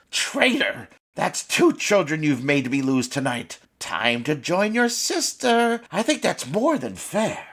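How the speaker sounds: cruel witch. Acted. Witch Sabine condemning Freddy. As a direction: gloat over the punishment.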